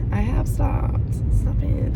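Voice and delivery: whispered, slow and laughing; breathy laughing whisper. Real, not acted. Teasing defence whispered at boyfriend.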